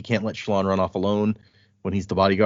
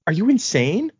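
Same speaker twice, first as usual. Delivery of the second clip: higher, incredulous question; 9 semitones higher than usual. Retold or imitated. Guard asking if she is insane, higher and incredulous. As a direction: ask it as if she's mad.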